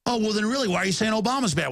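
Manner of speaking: mocking skeptic. Mocking a scoffing member of the public.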